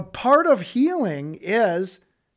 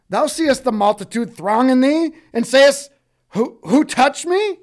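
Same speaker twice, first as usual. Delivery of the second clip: higher, louder, exasperated retort; incredulous disciple; 7 semitones higher, 9 dB louder than usual. Acted. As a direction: throw it back at him in disbelief. Disciple retorts, loud and high.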